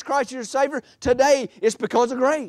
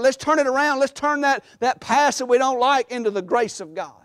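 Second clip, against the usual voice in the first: high, driving pulpit cadence; fervent preaching; 4 semitones higher than usual. Real, not acted. Preacher urging congregation to confront the past, fervent and high. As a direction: build in urgent repeated phrases.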